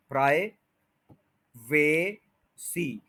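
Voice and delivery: प्राइवेसी in slow pieces with a long "vay"; common mispronunciation. Shown as a demonstration. Teacher shows what learners wrongly say, lengthened vowel. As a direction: drag it as "praai... vay... see" for प्राइवेसी. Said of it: "ए है उसको लंबा कर देते हैं".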